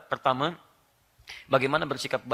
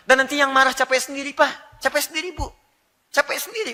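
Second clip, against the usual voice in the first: higher, louder, teasing; raised punchline; 9 semitones higher, 7 dB louder than usual. Real, not acted. Preacher teases the audience that the angry one tires himself.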